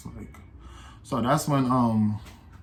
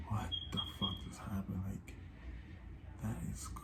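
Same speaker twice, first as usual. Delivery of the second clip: much softer, hushed disbelief; stunned whisper; 3 semitones lower, 17 dB quieter than usual. Real, not acted. After leaving the restaurant, a stunned hushed whisper. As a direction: breathe it out in shock. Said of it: "reacting in disbelief after leaving".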